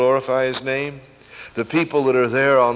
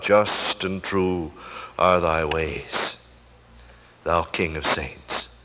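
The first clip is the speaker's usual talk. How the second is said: low, very slow, solemn awe; reverent confession; 6 semitones lower, 4 dB quieter, slower than usual. Acted. Redeemed sinners' confession to God.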